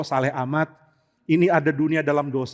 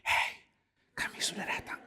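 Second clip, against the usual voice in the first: hushed mock announcement; whisper; 13 dB quieter than usual. Visitors announcing themselves without shouting, joking whisper. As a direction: whisper it as a joke. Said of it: "masa bisik?".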